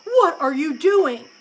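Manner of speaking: loud, indignant demand; outraged mother crow; shocked cry. A narrator's reading. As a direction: cry out at the thief. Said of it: "she cried".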